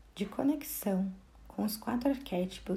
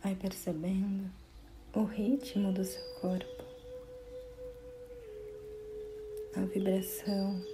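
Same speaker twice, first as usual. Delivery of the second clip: guided meditation; 9 semitones higher, 4 dB quieter than usual. Acted. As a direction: guide the listener gently inward, phrase by phrase. (meditação guiada, voz pausada e tranquila)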